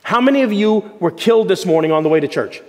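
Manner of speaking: ordinary delivery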